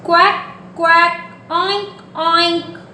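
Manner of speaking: playful animal imitations; duck and pig sounds. Shown as a demonstration. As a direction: play the duck and pig for toddlers.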